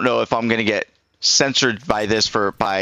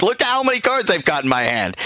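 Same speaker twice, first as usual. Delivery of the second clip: higher, gleeful boast about his hand of cards; gloating excitement; 7 semitones higher than usual. Retold or imitated. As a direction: show off the cards, laughing inside. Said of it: "I'm like".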